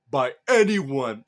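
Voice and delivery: slow, loud stress on the last word; emphatic warning. A narrator's reading. Thor warns against tarnishing warriors' pride, emphatic. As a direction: punch it out slowly and forcefully.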